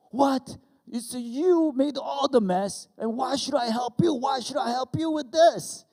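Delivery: very high, loud incredulous shouting; re-enacted angry outburst at full volume; outraged dad. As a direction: yell at the kid in disbelief. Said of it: "I got even angrier".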